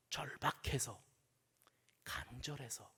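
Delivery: low, near-whispered intensity; hushed urgency. Acted. Preacher voicing the desperate woman's touch, hushed and urgent. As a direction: drop to a desperate hush.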